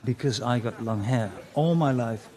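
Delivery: ordinary delivery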